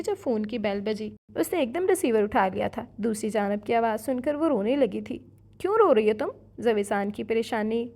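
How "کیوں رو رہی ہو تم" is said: worried raised voice. A narrator's reading. Worried man hears her crying.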